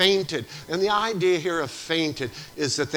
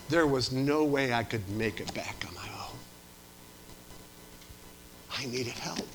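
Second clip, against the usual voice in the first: hushed, breathless confession; soft whispered testimony; 3 semitones lower, 7 dB quieter than usual. Retold or imitated. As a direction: whisper it as if still shaken. Rescued man's quiet testimony.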